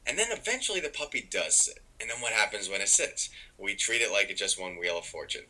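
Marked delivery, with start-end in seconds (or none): none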